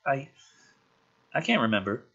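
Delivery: put-on a scared kid tripping over his words; stammering. Rory stammering to McKenna that he cannot remember, stuttering.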